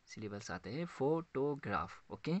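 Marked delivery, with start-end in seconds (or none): 0.8-2.0 "फोटोग्राफ" in three separate beats, फो-टो-ग्राफ; split into syllables. Shown as a demonstration. Teacher counting sounds for students, "फोटोग्राफ" split into three syllables. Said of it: "तीन syllables आते हैं".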